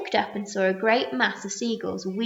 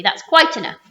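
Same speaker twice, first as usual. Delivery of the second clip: sharp warning; 5 semitones higher, 11 dB louder than usual. A narrator's reading. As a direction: cut him off firmly. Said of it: "the Earthworm said sharply".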